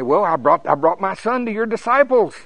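high, loud protesting father; loud complaint. Acted. Father explaining himself to Jesus about his son, loud complaint.

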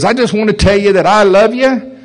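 lightly acted father forcing out a hard line; retold father.

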